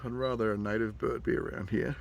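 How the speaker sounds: ordinary delivery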